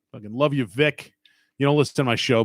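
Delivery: normal talking voice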